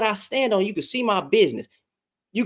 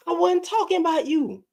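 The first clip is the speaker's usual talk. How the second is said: ordinary talk, nothing marked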